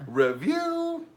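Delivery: loud, laughing drag host intro; campy drag queen. Retold or imitated. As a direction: belt it like a drag show host. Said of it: "I wanted to do welcome".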